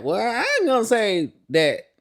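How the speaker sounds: Candy. Retold or imitated. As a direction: put on a high country accent. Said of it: "Candy gives us real country accent".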